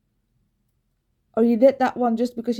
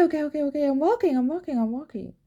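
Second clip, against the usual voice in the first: usual speaking voice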